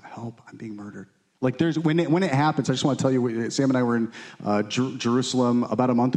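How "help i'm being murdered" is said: much softer, almost breathless plea; faint cry for help. A narrator's reading. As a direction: barely voice the desperate cry. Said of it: "crying this out".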